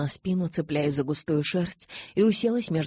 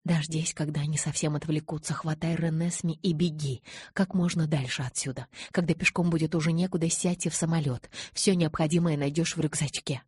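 ordinary delivery, nothing marked

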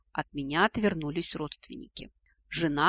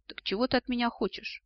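higher-pitched, guarded question; wary; 7 semitones higher than usual. A narrator's reading. Ancestor repeating his question to the descendant, wary. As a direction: ask again, on your guard. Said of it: "настороженно повторил вопрос предок".

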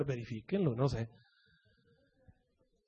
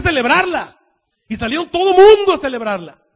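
building louder to a shout; fervent preaching climax; 6 semitones higher, 10 dB louder than usual. Acted. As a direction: let the celebration swell. (clímax de predicación, cada vez más fuerte)

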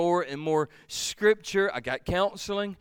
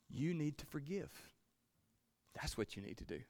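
gentle divine voice; 16 dB quieter than usual. Retold or imitated. Voice of God retold.

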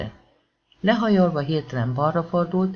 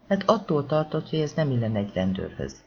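ordinary delivery

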